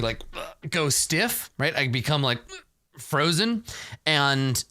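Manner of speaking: ordinary delivery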